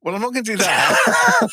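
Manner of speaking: laughing refusal. Real, not acted. Faced with the hard move, refusing with a laugh. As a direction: laugh as you back out.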